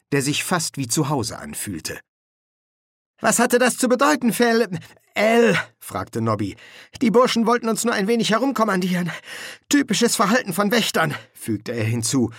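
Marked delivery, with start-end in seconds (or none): 3.2-5.6 high-pitched, puzzled Nobby; bewildered corporal. Acted. Nobby questions Colon after fright. 7.0-11.1 high-pitched, quick dismissive talk; dismissive corporal. Acted. Colon or Nobby explains.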